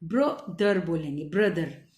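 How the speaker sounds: ब्रदर split low as bruh-der, then whole; low ब्रदर, broken first and joined after; blend then word. Shown as a demonstration. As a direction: sound out ब्रदर with BR as one blend. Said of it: "तो उसको ब्र".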